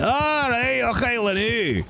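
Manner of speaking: high, exclaimed broad Scots; hammed-up Scottish accent. Shown as a demonstration. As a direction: belt out the phrase like a caricature Scot. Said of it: "My Scottish accent's getting really good".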